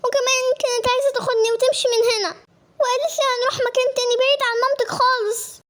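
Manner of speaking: put-on high-pitched, crying child's wail; tearful plea. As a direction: cry out to mama for rescue. Child runs crying to her mother. (استغاثة باكية)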